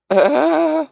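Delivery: short high giggle burst. Real, not acted. Host giggling between shout-outs, high giggle. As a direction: let a high little laugh escape.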